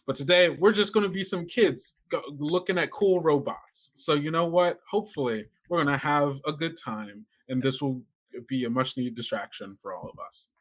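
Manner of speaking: normal talking voice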